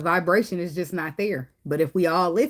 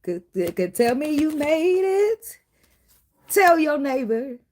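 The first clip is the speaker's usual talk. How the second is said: preacher call; 7 semitones higher, slower than usual. Real, not acted.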